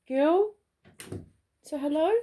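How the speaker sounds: high, loud cooing to the dog; pet talk. Real, not acted. Owner introducing her dog to viewers, high pet talk.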